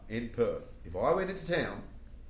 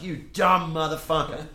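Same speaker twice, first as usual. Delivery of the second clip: raised, louder mock telling-off; exasperated scolding; 5 semitones higher, 7 dB louder than usual. Friends scolding Woody once they escaped, mock exasperation. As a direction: lay into him with retold exasperation.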